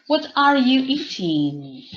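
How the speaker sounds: slow and loud, "are" pushed up; emphatic model answer. Shown as a demonstration. Teacher confirming a student's answer, loud and slow.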